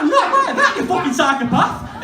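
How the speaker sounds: a high, loud yell of alarm; horrified shout. Retold or imitated. Stopping his friend mid-orgy with a high alarmed yell. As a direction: shout him off in a laughing panic. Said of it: "I'm like".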